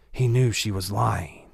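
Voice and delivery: ordinary delivery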